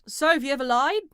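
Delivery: loud preacher-style questioning in an imitated accent; Ray Comfort's accent. Host mimicking an evangelist's spiel to a caller, loud imitated accent. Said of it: "a dead-on impression of his accent".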